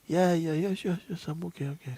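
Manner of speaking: high, soft, hurried yeses; retold reassurance. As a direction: play a man insisting he's fine. Voices man insisting he is fine.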